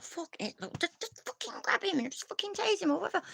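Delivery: high-pitched exasperated husband impression; squeaky, fed-up rant. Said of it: "It drives him wappy, he's like".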